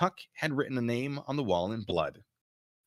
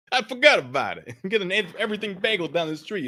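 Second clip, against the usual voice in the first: loud, high Brooklyn voice breaking into laughs; Brooklyn accent; 11 semitones higher, 6 dB louder than usual. Acted. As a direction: fumble the Brooklyn line, laughing.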